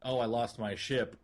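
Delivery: usual speaking voice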